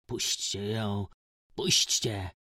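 put-on slow drunken slurred plea; mumbling. A narrator's reading. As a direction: slur it like a dazed drunk. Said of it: "bełkotał Antek".

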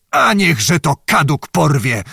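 ordinary delivery

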